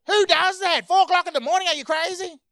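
very high, loud incredulous outburst; outraged yelling. Acted. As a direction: shout in disbelief at an absurd request. Acted outraged reply to ice-cream request.

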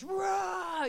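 high shouted mock roar; children roaring the animal name; kids' roar. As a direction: roar like a playful bear. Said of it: "they were imitating the, the sound".

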